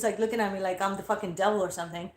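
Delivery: ordinary delivery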